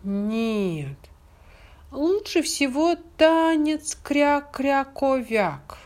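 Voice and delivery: high quacking duckling; squeaky little duck objecting. Acted. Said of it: "возразил утёнок Крячик".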